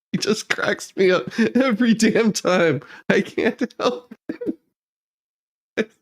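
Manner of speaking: usual speaking voice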